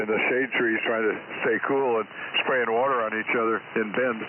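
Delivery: normal talking voice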